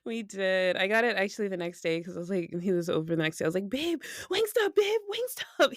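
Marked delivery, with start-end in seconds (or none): 3.7-5.7 put-on high, excited yelling at boyfriend; yelling for Wingstop. Girlfriend yelling at boyfriend for Wingstop, high and excited. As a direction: call out high and eager, begging. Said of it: "I just, like, yell stuff at him".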